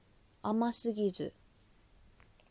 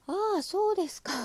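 surprised; 10 semitones higher, 7 dB louder than usual. Retold or imitated. Retold shock at show date.

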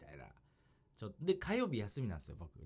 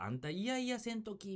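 high scolding mom voice, higher; scolding mother; 10 semitones higher than usual. Retold or imitated. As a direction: imitate a nagging mother. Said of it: "母親とかにさ".

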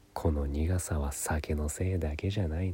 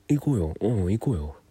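eager yes; 5 dB louder, faster than usual. Retold or imitated. Eagerly accepting a girl's invitation.

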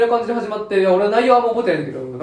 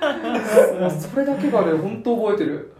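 after laughing hard; 3 semitones higher, slower than usual. Real, not acted. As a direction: still laughing, words breaking up.

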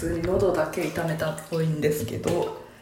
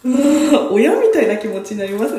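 gushing fangirl; 9 semitones higher, 5 dB louder than usual. Real, not acted. Gushing over her favourite idol.

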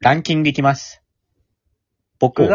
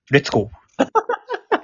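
playful cheer; 11 semitones higher, 3 dB quieter, much slower than usual. Real, not acted. Playful rallying call to listeners.

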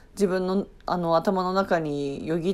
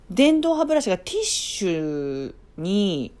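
normal talking voice

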